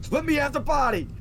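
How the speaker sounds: high loud demand; high mock yell. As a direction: shout it like a mock plea. Mark demanding control.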